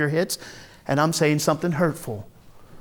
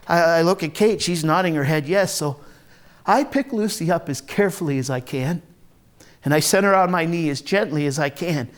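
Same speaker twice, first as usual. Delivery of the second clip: ordinary talk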